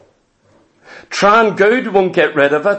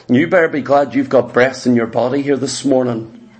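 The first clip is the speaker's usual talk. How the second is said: usual speaking voice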